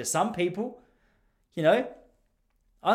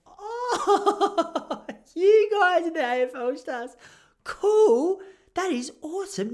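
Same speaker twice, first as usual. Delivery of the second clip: high, drawn-out, overdone awe; flustered fake enthusiasm; 12 semitones higher, 4 dB louder, much slower than usual. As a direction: cover being caught off guard with a big, high "cool". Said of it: "I was flustered".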